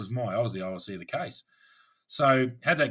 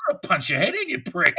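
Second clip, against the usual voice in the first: higher, louder mock rage; imagined angry outburst; mock furious threat; 8 semitones higher, 8 dB louder than usual. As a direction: spit it out like a hammed-up threat. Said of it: "I'm sitting there going".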